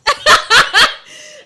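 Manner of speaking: high loud burst of laughter; cracking up hard; shrieking laugh. Real, not acted. Hysterical laughter.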